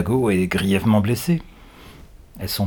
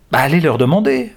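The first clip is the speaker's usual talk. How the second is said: put-on higher, loud girl's retort; teenage girl retort; 5 semitones higher, 6 dB louder than usual. A narrator's reading. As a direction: toss the question back at him. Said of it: "réplique l'adolescente".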